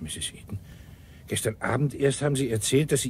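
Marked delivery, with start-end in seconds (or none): 0.0-0.6 voiceless, pointed hush; hushed triumphant note; knowing whisper. Acted. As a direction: whisper her name as a trap. Pointing out her contradiction.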